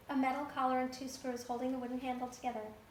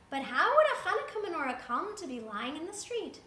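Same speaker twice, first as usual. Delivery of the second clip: Beryl wondering; 5 semitones higher, 6 dB louder than usual. A narrator's reading. Beryl voiced in told story.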